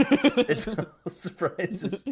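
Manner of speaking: high, very slow echo through laughter; laughing. Real, not acted. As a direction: repeat it while cracking up. Laughing echo of the acted quote.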